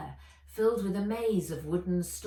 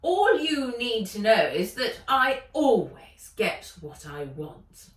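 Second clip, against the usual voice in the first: gloating villainess, louder; Ivy, gloating; 3 semitones higher, 9 dB louder than usual. Acted. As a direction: brush him off with contempt.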